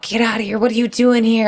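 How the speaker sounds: high-pitched mock yell; retold yelling. Falconer retelling the man telling her to get out, mock yelling. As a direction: imitate an irate man yelling, pitched well up. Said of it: "he's yelling at me, just totally yelling".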